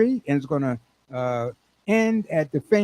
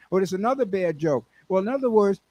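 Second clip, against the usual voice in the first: normal talking voice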